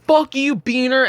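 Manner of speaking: high, drawn-out taunt; mock jeering. Drunk kids cursing the guard as they go off, mock jeering. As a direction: imitate a parting insult, stretched and high. Departing drunks hurl an insult back.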